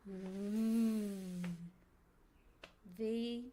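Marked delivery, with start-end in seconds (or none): 0.1-1.4 low held buzzing hum; voiced fricative hum. Shown as a demonstration. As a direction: let the buzz ring easy. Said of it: "our voice fricatives".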